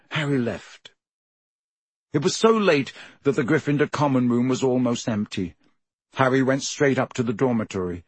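ordinary delivery, nothing marked

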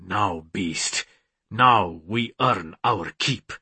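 low, slow address to the beast within; grim resolve. A narrator's reading. Man rousing his inner beast, grim and low.

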